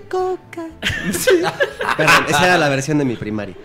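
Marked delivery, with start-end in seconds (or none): 0.7-1.9 real laugh; high burst of laughter. Real, not acted. Laughs at own joke.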